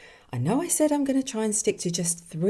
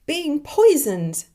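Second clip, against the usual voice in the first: high, loud, drawn-out shriek; 11 semitones higher, 8 dB louder, slower than usual. A narrator's reading. Dead woman shrieks her regret at the angel. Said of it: "she shrieked".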